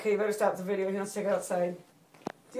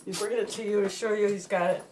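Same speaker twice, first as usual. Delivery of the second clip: ordinary delivery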